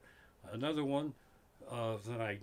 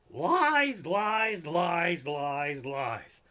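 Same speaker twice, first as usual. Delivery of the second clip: fading shout; 3 semitones higher, 6 dB louder, much slower than usual. Acted. Comic ranting at a saying, shouting then fading. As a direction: shout it down, then let it fade.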